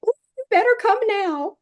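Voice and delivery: very high, rapid fly voice; warning fly. A narrator's reading. Fly warns Chipmunk to hurry, high and rapid. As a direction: squeak an urgent warning fast. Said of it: "Messenger Fly warned".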